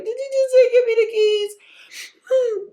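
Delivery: very high, loud, drawn-out mock bawl; mock ugly-cry wail. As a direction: stretch the question into a hammed-up howl. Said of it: "I had an ugly cry".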